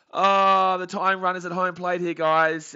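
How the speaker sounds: high, very loud cry of dismay. Real, not acted. Streamer warning viewers of the tying run, loud dismay.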